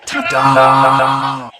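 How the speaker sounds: loud laughing ta-da; laughing triumphant shout. Acted. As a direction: show off the prize. Revealing the cake.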